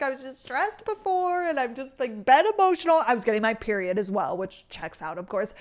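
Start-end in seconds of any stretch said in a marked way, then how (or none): 0.0-3.0 mock teary voice, higher; retelling own crying. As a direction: replay your own weepy explanation. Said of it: "And I was like".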